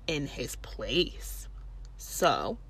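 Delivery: slow, pointed whisper; hushed, slow, accusing; whispered accusation. Retold or imitated. Accusing the boyfriend to cop.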